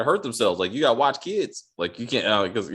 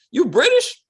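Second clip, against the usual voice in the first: shocked high squeak; 6 dB louder, slower than usual. Retold or imitated. Asking the guest, high and stunned. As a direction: pitch leaps up in disbelief. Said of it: "I was like".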